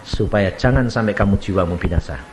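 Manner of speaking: normal talking voice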